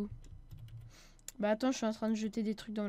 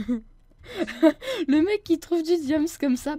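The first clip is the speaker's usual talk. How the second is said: laughing; 5 semitones higher, 7 dB louder, much slower than usual. Real, not acted. Laughing, slow mock.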